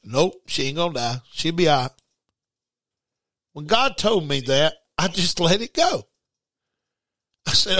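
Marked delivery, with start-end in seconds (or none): 0.0-1.9 lower, settled reassurance; lightly voiced God answering; calm voice of God. God reassuring the worried husband, low and calm. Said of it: "He said".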